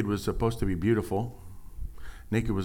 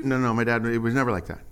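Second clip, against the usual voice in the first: usual speaking voice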